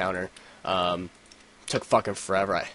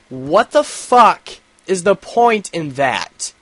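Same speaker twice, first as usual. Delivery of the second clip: slow, high, loud disbelief, drawn out; incredulous rant; 8 semitones higher, 8 dB louder, much slower than usual. Retold or imitated. Vlogger ranting to viewers about a woman's earpiece, slow incredulous outrage. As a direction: stretch each word out in exasperated disbelief.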